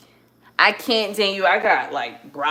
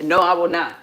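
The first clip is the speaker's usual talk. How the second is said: usual speaking voice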